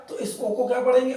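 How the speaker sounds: usual speaking voice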